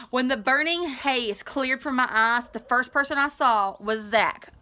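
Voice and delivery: ordinary delivery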